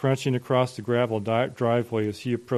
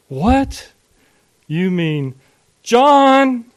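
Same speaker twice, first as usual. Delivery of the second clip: high, loud burst of disbelief; shocked outburst; 11 semitones higher, 9 dB louder, much slower than usual. A narrator's reading. Father learns of the abortion, loud and shocked. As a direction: exclaim in shock, peaking on the name.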